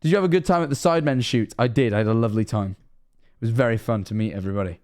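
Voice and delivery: usual speaking voice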